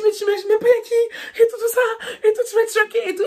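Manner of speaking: high, loud half-laughing half-crying; teen girl in a giggly panic telling her friend; panicked squeal. Retold or imitated. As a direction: squeal it out, overwhelmed and giddy.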